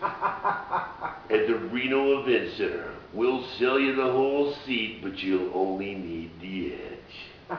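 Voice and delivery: slow, stretched-out promo delivery; hammed-up arena ad read; commercial announcer voice. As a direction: sell the show, dragging out the words. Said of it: "You gotta use that voice".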